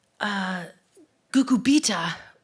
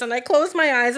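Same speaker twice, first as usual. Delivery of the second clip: ordinary delivery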